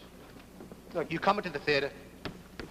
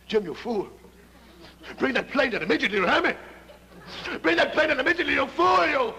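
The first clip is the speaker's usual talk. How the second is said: frantic shout; 6 semitones higher than usual. Acted. Colonel yells over the radio at Jim, building shout. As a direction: bellow after the pilot, building to a scream.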